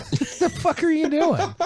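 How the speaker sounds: high, squeaky mock disbelief; incredulous rant. Mocking the article writer, high incredulous yelp.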